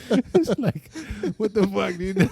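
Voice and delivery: high squeaky laughing outrage; laughing disbelief. Real, not acted. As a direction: laugh through the words in disbelief.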